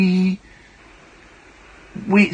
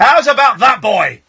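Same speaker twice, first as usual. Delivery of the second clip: mock threat; 4 semitones higher, 7 dB louder than usual.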